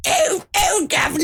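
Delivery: put-on high, hammy cockney English accent. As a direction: play up a stage cockney. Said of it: "Do an English accent".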